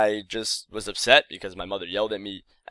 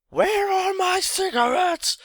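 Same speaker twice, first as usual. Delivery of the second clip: imitating mom's shrill high scream from downstairs, slowly; roaring scream; 4 dB louder, slower than usual. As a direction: hurl it up the stairs as a furious high yell. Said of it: "she makes this kind of roaring scream".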